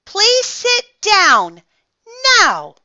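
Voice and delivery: high, slow, loud command dropping on the last word; loud parental order. Acted. Mom telling Froggy to sit, loud order.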